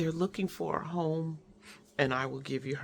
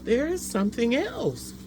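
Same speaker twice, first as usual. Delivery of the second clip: higher, louder burst of discovery; retold thrill of noticing more; excited realization; 8 semitones higher, 5 dB louder than usual. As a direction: gasp it out like you just spotted a surprise. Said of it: "And so then I thought".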